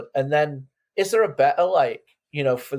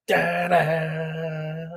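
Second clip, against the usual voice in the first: vocal drum imitation; 4 semitones higher than usual.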